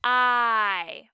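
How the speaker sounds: I said alone like the word eye; a long, full I, like eye; long I sound. Shown as a demonstration. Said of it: "which says".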